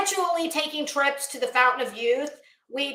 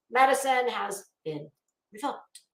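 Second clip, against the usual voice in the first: ordinary talk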